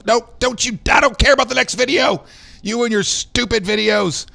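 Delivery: playful fake anger at the stupid videos; mock angry outburst. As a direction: yell at the video in mock rage. Mock scolding the video player.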